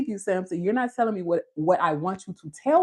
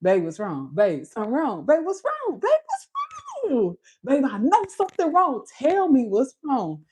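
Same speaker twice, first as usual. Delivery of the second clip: rising, pestering babe what's wrong; nagging wife; 5 semitones higher than usual. Retold or imitated. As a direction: keep climbing higher, nagging her husband. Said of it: "I'll be like".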